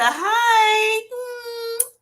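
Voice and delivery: high, long-held "hi" sliding into a hum; sing-song greeting. Real, not acted. Greeting Miss Linda in the chat, sing-song. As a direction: draw out a high, singing hello.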